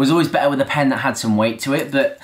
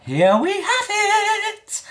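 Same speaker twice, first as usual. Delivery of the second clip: excited announcement; much slower than usual. Real, not acted. Big reveal of the sheet.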